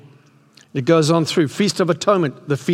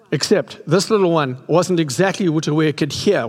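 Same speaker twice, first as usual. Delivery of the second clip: usual speaking voice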